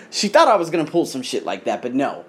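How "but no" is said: usual speaking voice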